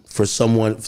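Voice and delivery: normal talking voice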